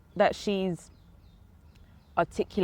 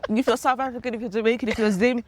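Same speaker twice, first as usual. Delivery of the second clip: put-on Jamaican accent; 6 semitones higher, 5 dB louder than usual. Playfully welcoming all Africans.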